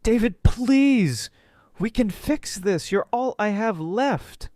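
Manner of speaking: high, loud desperate pleading; screaming. Acted. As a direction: scream and beg him to take you back.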